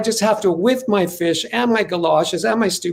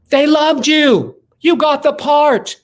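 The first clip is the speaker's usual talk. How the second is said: much higher, louder, drawn-out joyful announcement; thrilled news; 10 semitones higher, 6 dB louder, slower than usual. Retold or imitated. The agent tells him he got the part, much higher, louder and slow. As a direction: deliver the news like you can't believe it.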